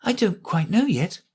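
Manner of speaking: put-on high, gentle girl voice; very gently. A narrator's reading. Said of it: "Alice said very gently".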